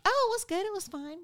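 high, breezy mock nonchalance; a hammed-up it's-no-big-deal; faking casual. Playing it cool after tour.

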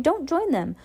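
high-pitched plea; crying out. A narrator's reading. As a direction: plead in a high, urgent voice. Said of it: "Klaus cried".

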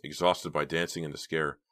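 ordinary delivery, nothing marked

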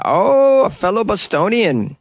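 delighted surprise. Real, not acted. Delighted at Boston listener.

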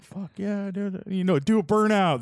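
imitating booth guys yelling for a burnout; crowd yelling. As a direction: egg him on like a rowdy crowd. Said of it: "They're all yelling".

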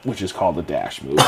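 usual speaking voice

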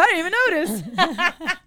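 laughing it out, high and loud; half-laughed high exclaim; laughing surprise. Retold or imitated. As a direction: blurt it through a laugh, amazed. Laughing retelling own surprise.

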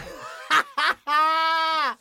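shouting laugh. Real, not acted. Big laugh.

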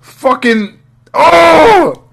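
hammed-up frustrated yell at the screen; exasperated outburst. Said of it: "I was like".

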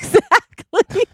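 real laugh, high, then the word; cracking up mid-word; burst of laughter. Real, not acted. Partner cracks up.

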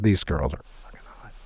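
unvoiced hushed aside; whispering. Real, not acted. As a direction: drop to a whisper for emphasis. Whispered aside about the girls.

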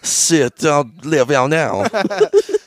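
very slow, loud mock-French; French accent. Giving his name as Mister French, slow French accent. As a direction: draw out every syllable in a hammy French accent. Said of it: "He's French".

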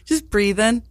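ordinary talk, nothing marked

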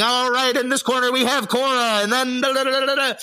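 high, booming boxing ring announcer; old-timey announcer. Retold or imitated. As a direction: call the fighters like an old-time ring announcer. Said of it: "who's got that old-timey announcer voice".

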